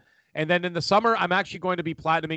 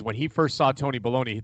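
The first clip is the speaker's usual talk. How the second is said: usual speaking voice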